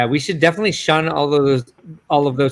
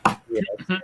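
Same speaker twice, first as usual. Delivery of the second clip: usual speaking voice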